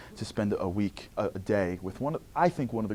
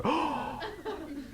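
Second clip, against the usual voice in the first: put-on high delighted child gasp; excited gasp; 7 dB quieter than usual. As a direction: gasp at finding treasure. Kid discovers cookie aisle.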